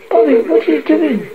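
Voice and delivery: high-pitched worried call; alarmed cry. A narrator's reading. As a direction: call anxiously after a child. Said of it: "she cried".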